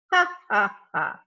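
loud mock ha ha ha; triumphant laugh. Mister Dark gloating over the caught thieves, hammed-up laugh. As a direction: laugh at your own joke, hammed-up.

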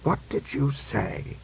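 slow, hushed hoarse outrage; hoarse and drawn out, in disbelief; hoarse cry. A narrator's reading. General challenging the officer's protest, hoarsely. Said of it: "cried hoarsely".

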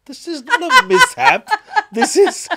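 high, laughing delight. Real, not acted. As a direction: laugh through the words. Host delighted by the story.

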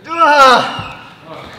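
loud gasp of relief; strained cry of effort. Real, not acted. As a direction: let out a sharp effort shout. The plank just ended.

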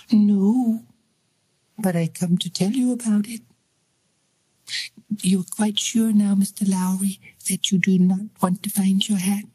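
a ghost girl playing innocent; eerie child voice. Ghost child answers Lowry.

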